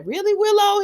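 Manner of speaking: high-pitched, put-out objection; mock protest. Acts out protest at being stopped.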